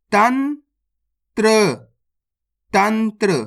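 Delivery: usual speaking voice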